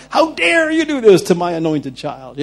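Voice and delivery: loud, high, hammed-up wrath; mock furious outrage. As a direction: thunder it like an avenging protector. Imagined Jesus clubbing the offenders.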